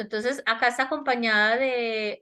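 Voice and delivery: ordinary delivery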